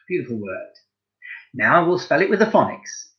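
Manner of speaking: ordinary talk, nothing marked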